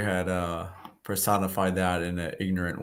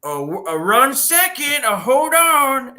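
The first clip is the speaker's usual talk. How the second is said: Master Splinter; 6 dB louder, slower than usual. Retold or imitated.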